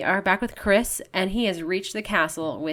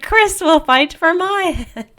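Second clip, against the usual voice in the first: high, loud, slow hammed-up declaration; mock-heroic proclamation; 9 semitones higher, 11 dB louder, slower than usual. Lady Claire naming Chris as her champion, mock-heroic. Corrects the quote, still hammed up.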